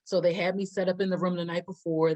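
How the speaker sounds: ordinary talk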